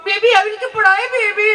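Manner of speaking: high-pitched fussing mum voice; flustered mother. Acted. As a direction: rush in high to fuss over a toddler. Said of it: "mummy બિચારી આંફળી".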